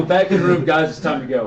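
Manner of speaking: loud mock call to the party; half-laughing shout to round everyone up. Player calling the guys back in the room, playful shout.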